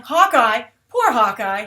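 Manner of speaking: usual speaking voice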